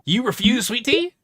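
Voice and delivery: can't believe it; astonished. Real, not acted. Disbelief at refusing tea.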